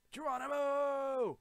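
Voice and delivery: high, loud, long-held yell; leaping battle cry. Pretend. Gamer leaping off, long high Geronimo yell. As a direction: stretch the last vowel as you jump.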